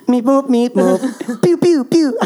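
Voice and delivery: high beeping robot sounds; robot noises. Pretend. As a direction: bleep like a toy robot. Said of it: "our best robot voice noise".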